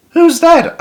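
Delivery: put-on startled child frog. Young frog frightened question.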